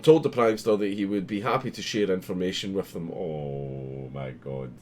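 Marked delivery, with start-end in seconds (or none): none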